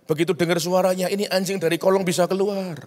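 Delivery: ordinary talk, nothing marked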